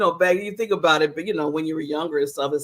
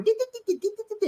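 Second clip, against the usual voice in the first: much higher, fading di-di-di chatter; mock babble; 14 semitones higher, 4 dB quieter, much faster than usual. Mocking the group's chatter, high fading nonsense babble. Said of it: "And then we're".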